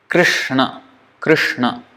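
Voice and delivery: "Krishna" with a single tapped R sound, no vowel after the K; correct Sanskrit Krishna. Shown as a demonstration. Teaching students the correct Sanskrit Krishna, clear demo. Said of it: "the actual pronunciation of the word Krishna".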